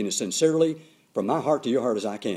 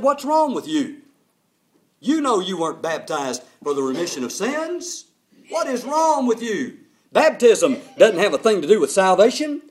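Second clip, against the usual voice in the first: scolding; 6 semitones higher, 4 dB louder than usual. Retold or imitated. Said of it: "kind of dressed him down".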